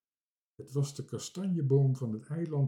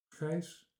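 softly murmured name, a little higher; 4 semitones higher, 5 dB quieter than usual. A narrator's reading. Sailor's name murmured by the little captain, murmuring. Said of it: "mompelde hij".

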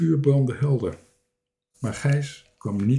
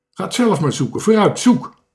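loud, raised, bossy command; shouting; 7 semitones higher, 6 dB louder than usual. A narrator's reading. Strange man sends visitors off to search, shouting. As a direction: build to a high, loud order. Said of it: "schreeuwde hij".

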